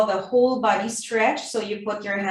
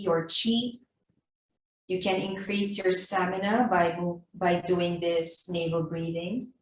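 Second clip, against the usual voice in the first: guided meditation. Acted. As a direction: gently lead them inward. Guided navel breathing meditation.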